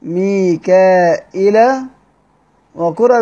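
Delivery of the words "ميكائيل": ميكائيل said slowly with a hamza after the long a; ميكائيل slowly with hamza after alif; added hamza. Shown as a demonstration. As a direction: stretch ميكائيل, mika then hamza then il. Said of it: "بزيادة همزة بعد الألف".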